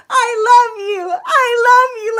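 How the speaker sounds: high, loud, tearful shouting; shouted with crying. Retold or imitated. Whole room shouting love at each other, tearful shout. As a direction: cry it out at full volume. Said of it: "the whole room was like".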